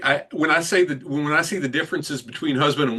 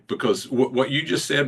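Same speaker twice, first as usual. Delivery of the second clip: ordinary talk, nothing marked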